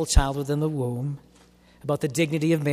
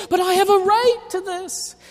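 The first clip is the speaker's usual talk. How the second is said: much higher, mock entitled whine; 14 semitones higher, 4 dB louder than usual. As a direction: parody a petulant demander. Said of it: "And they'll say".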